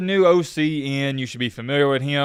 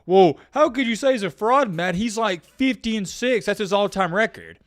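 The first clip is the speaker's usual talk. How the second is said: mocking fan voice; 7 semitones higher than usual. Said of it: "The one thing they say".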